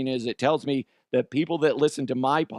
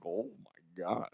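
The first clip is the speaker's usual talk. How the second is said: slow, much softer disbelief; mock stunned hush; 4 semitones lower, 11 dB quieter, slower than usual. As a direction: let it drop low, drawn out in mock shock. Retelling his stunned reaction to innuendo.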